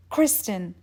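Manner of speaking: usual speaking voice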